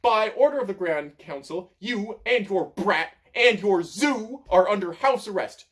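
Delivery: high, loud accusing shout; yelling. A narrator's reading. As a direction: shout the verdict at them. Pothos keeps yelling accusations.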